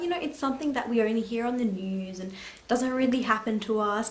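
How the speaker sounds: usual speaking voice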